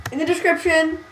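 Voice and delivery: announcer-like plug. Pretend. Acts a video maker's plug.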